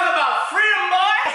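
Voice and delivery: high-pitched, exuberant holler; excited whoop. Acted. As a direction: cry it out high in triumph. Whooping about freedom.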